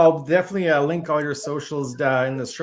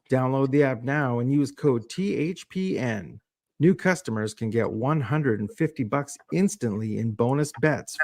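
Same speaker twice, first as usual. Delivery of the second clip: measured, evenly paced announcer read; scripted radio-ad delivery, lower than chatting; sponsor ad read; 4 semitones lower than usual. Acted. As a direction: read out the promo and fine print clearly. Reads a sponsor ad and disclaimer.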